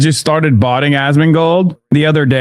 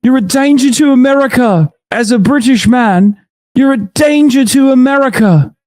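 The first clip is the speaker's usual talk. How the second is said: put-on British accent; 11 semitones higher than usual. Mocking a British streamer calling him a danger, British accent. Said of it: "hearing a British person go".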